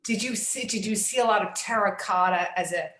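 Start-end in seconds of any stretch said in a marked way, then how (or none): none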